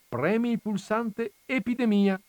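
lightly voiced little boy; a small son asking eagerly; child voice. A narrator's reading. Child voice in read story.

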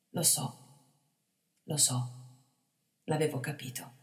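soft, gentle with a chuckle; good-natured. A narrator's reading. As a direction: kindly reassure the boy. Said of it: "disse bonariamente Planetta".

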